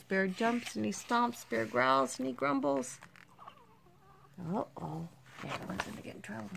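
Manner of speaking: ordinary delivery, nothing marked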